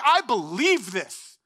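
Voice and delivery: drawn-out, emphatic declaration; earnest conviction. Acted. Believer affirming faith, not lip service, with emphatic conviction. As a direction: declare it slowly, with full conviction. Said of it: "not just kinda giving it lip service".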